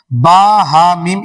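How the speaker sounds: slow, deliberate "با ہا میم"; teacher's slow "با ہا میم"; slow spelling. Shown as a demonstration. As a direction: spell "با ہا میم" out slowly for the class. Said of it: "اس کو کیا پڑھتے ہیں".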